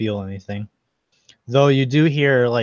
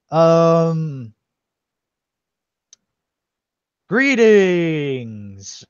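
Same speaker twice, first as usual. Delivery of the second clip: loud, higher, drawn-out ceremonial greeting; old statue; 5 semitones higher, 4 dB louder, much slower than usual. Acted. Old statue greeting visitors after being woken, muddled and loud. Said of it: "the voices sound old".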